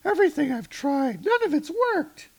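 hammed-up high voice for Jane; Jane's voice. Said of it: "Jane is like".

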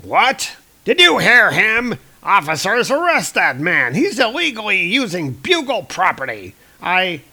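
outraged Jonah. Retold or imitated. Jonah orders officers to arrest Spider-Man, loud and outraged. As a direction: bluster at the officers.

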